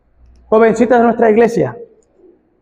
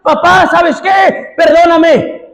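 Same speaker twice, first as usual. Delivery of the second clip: predicando a gritos, voz alta y aguda; predicación a todo pulmón; 7 semitones higher than usual. Acted. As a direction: clama como predicador encendido. Preacher voicing prodigal son's plea. (predicador gritando la súplica del hijo pródigo)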